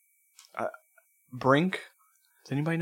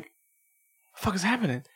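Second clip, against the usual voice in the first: baffled, pitched up; confused; 6 semitones higher, 4 dB quieter than usual. Retold or imitated. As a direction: sound lost and confused. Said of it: "I'm like".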